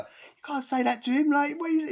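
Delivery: mock outraged objection to the officer; incredulous protest. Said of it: "I was like".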